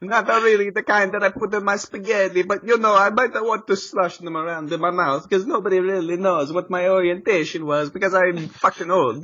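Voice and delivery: high hammed-up Italian accent. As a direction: play it like a cartoon Italian. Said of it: "He's like".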